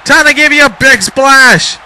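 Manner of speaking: high, loud mock wrestler taunt; voicing Brodus. Commentator voicing Brodus's taunt, high and loud. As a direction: play Brodus calling his big splash. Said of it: "Brodus says".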